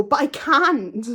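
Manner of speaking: loud, high, slow exasperated snap; annoyed outburst. Retold or imitated. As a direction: burst out, fed up. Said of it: "I get really annoyed at this point".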